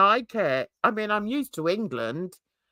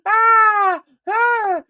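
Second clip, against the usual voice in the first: loud high whoop of laughter; real burst of laughter; shrieking laugh; 12 semitones higher, 9 dB louder than usual. Real, not acted. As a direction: let out a cackling shriek. Bursts out at Guinness joke.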